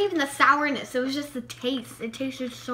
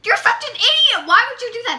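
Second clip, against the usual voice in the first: loud; 9 semitones higher, 10 dB louder, faster than usual. Real, not acted. Scolding friend, laughing.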